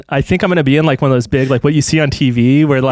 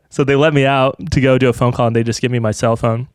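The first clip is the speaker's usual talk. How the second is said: normal talking voice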